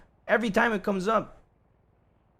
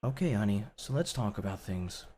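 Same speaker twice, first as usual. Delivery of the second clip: mock podcast host; 9 semitones lower, 7 dB quieter than usual. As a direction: put on a soothing radio voice to mock her. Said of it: "sounding like a podcast host".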